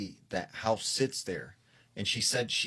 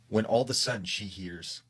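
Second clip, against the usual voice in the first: ordinary delivery, nothing marked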